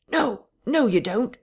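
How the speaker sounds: sudden high, loud protest; sharp cry. A narrator's reading. Vicar crying out during the struggle in the parlour, sharp and high. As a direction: yelp it out in alarm. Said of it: "a sharp cry of".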